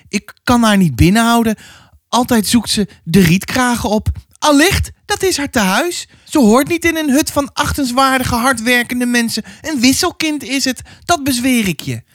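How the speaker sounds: put-on high, pressing old woman's voice; stern old neighbour. Old neighbour warning the mother about her child, high and pressing. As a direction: lean in like a suspicious village gossip.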